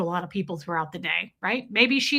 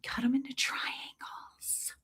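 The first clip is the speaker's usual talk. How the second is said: slow, very soft whisper; mom's whisper; 3 semitones higher, 12 dB quieter, slower than usual. Retold or imitated. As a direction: hiss out the tip in a secret whisper. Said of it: "she does whisper sometimes".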